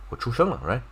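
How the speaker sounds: normal talking voice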